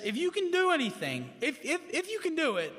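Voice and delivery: high-pitched, insistent mock plea; stammered, imploring retelling; retold plea. Retold father begging Jesus for help.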